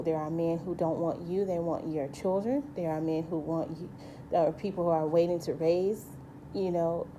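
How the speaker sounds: normal talking voice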